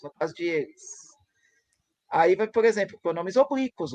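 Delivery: ordinary talk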